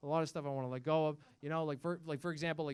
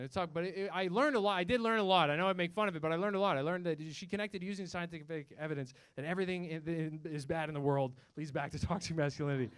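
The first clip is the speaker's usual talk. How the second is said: normal talking voice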